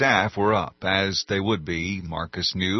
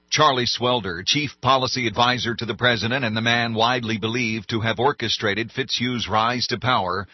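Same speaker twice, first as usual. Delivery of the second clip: ordinary delivery, nothing marked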